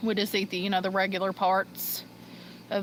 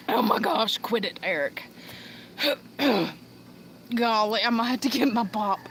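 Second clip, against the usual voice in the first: usual speaking voice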